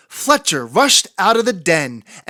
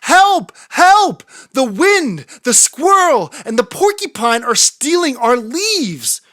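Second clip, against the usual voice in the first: high, loud cry for help; 7 semitones higher, 4 dB louder than usual. A narrator's reading. Little fox shouting as porcupine rolls off with leaves. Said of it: "cried Fletcher".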